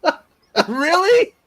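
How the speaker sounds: high, loud incredulous question; can't believe it, really. Real, not acted. Fan reacting to the show's ending, incredulous.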